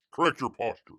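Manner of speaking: ordinary delivery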